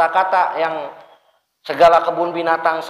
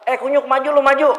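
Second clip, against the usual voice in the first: rude gamer taunt; 8 semitones higher, faster than usual. Retold or imitated. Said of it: "Atau lagi mabar nih".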